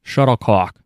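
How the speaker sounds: shuttlecock said with a Boston accent. Real, not acted. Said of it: "Why are you saying it like you're from Boston?".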